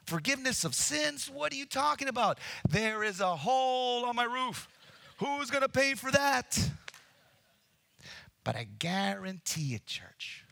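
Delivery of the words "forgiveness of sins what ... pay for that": high, loud, fast outrage over the roof; mock-furious landlord about the hole; angry homeowner. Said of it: "heard the owner of the house".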